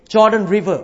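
usual speaking voice

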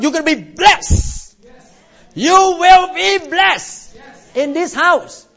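slow, high, stretched-out blessing; fervent proclamation. Real, not acted. Pastor promises blessing to the church, fervent proclamation. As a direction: proclaim it with drawn-out force.

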